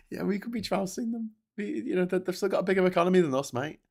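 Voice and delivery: lightly voiced pub know-it-all; mock Brexiter. Mocking a Brexiter's argument.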